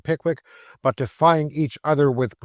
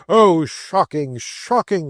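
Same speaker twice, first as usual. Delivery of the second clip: mock horror; 4 semitones higher, 5 dB louder, slower than usual.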